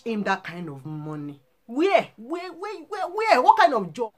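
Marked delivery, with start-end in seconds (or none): 1.7-4.1 high, loud repeated where; indignant demand. Real, not acted. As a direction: press the question hard, higher each time. Demanding where the money came from.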